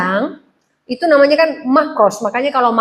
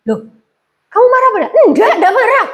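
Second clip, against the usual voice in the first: high-pitched voice; 9 semitones higher, 4 dB louder, faster than usual. Shown as a demonstration. Husband asks wife if she is angry, high-pitched. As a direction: pitch jumps way up, tense and loud. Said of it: "Coba tinggi suaranya".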